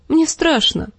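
высоко, с испугом; выкрикнуть страх, выше своего голоса; испуганный возглас. A narrator's reading. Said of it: "крикнула Санса". (Санса на краю кричит от страха)

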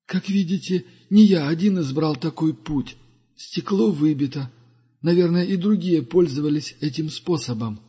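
lightly voiced wise old pilgrim; Duga's voice. (голос мудрого странника)

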